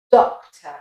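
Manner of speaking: British Doctor ending in "tuh"; British accent. Shown as a demonstration. Teacher repeating Doctor for learners, British accent. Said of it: "and as an American accent".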